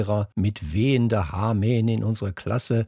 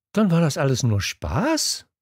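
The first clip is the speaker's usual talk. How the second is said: higher-pitched, disbelieving question; incredulous exclamation; 7 semitones higher than usual. A narrator's reading. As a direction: blurt it out in disbelief, rising at the end. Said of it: "rief ich ungläubig, leider etwas zu laut". (ungläubig ausgerufen)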